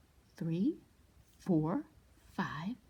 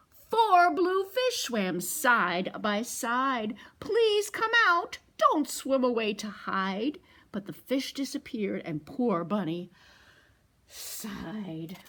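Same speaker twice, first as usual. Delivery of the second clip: louder, slow sing-song read-aloud; picture book read to toddlers; storytime reading; 2 semitones higher, 6 dB louder, slower than usual. A narrator's reading. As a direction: read it slowly and big for the kids.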